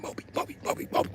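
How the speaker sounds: soft unvoiced whispered chant; whispering. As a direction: whisper it down the line. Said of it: "start whispering to everybody".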